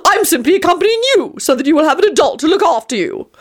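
high, prim self-justification; high-pitched huffy explanation; wailing Tony. A narrator's reading. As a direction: insist like a flustered grown-up. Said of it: "Tony wailed".

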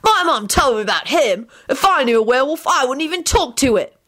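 high, disdainful sneer; scathing. A narrator's reading. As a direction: put the friend down with contempt. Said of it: "in a scathing tone".

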